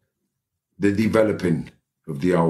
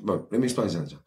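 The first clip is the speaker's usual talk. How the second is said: normal talking voice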